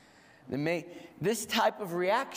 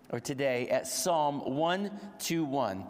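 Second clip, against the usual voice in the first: normal talking voice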